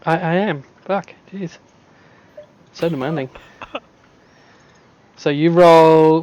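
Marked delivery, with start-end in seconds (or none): none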